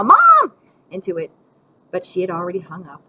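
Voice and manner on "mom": very loud, high cry; yelling. A narrator's reading. Boy grabbing the receiver to reach Mom, yelling. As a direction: yell it desperately down the line. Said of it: "pick it up and yell".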